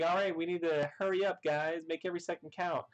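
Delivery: high, quick mock rush; excited hurry. Pudding rushing the chefs, in a high quick voice. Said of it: "She's like".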